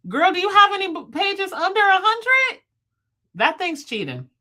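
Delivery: high, loud incredulous question; can't believe how few pages; shocked disbelief. Real, not acted. Reacting to chat, shocked.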